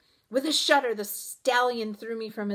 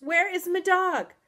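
loud, high, frantic question; alarmed yell; shouted demand; 10 semitones higher, 9 dB louder than usual. A narrator's reading. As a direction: shout it at the horse. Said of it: "I shouted to the horse".

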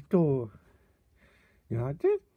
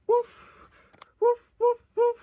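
high, louder pretend woofs; imitated dog bark; 12 semitones higher, 5 dB louder than usual. Barking like a dog between remarks, high imitated woofs.

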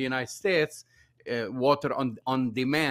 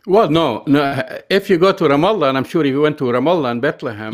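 emphatic loud objection; 11 dB louder, faster than usual. Real, not acted.

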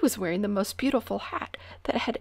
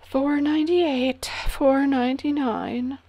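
tired voice; 7 semitones higher, 6 dB louder than usual. A narrator's reading. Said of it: "broke in the tired voice of Sir Alaric".

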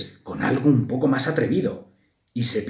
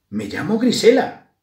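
higher, louder mouse voice; little mouse; 10 semitones higher, 6 dB louder than usual. A narrator's reading. Grisela introduces herself to another mouse, perky. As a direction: answer in a perky small voice. Grisela gives her name.

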